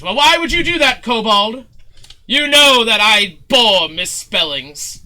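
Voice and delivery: pompous showman scolding. Acted. Showman scolding kobold over misspelt flyers, outraged. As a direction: berate the servant with grand indignation.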